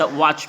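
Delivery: वॉच with a long, open "aw"; stretched vowel. Shown as a demonstration. Teacher showing the vowel inside, वॉच drawn out. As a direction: draw out the middle of वॉच. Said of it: "यानी अ आ रहा है".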